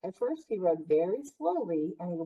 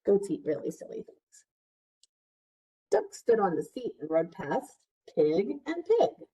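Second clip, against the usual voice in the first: usual speaking voice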